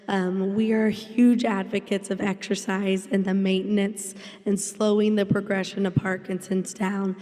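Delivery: normal talking voice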